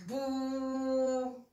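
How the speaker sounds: bou with the vowel drawn out, slow; "bou" allongé; long bou. Shown as a demonstration. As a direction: stretch the ou in bou. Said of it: "pour prolonger ... la petite voyelle".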